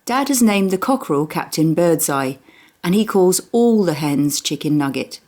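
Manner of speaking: ordinary delivery, nothing marked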